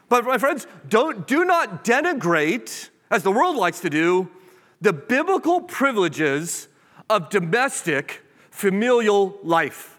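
full-force sermon delivery; fervent preaching. Acted. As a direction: preach it with rising conviction.

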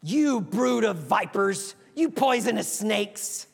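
slow, scornful denunciation, lightly voiced; harsh rebuke. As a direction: spit out the insult deliberately, a hint of a fierce prophet. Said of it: "would say something really nice like".

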